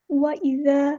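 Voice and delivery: وَإِذَا with ذ said soft and light, not hard; soft dhal. Shown as a demonstration. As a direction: soften the ذ in وَإِذَا. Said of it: "زال کو ہم نے نرمی سے پڑھنا ہے".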